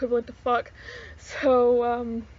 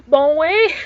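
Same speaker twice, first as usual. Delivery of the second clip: Quebecer accent; 5 semitones higher, 10 dB louder than usual. Shown as a demonstration. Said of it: "it talks like this".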